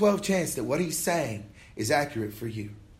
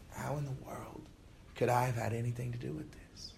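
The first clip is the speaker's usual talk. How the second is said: much softer, puzzled musing; bewildered inner thought; 2 semitones lower, 10 dB quieter than usual. Retold or imitated. Preacher replaying his own doubts, softer and bewildered. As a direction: murmur it to yourself in disbelief.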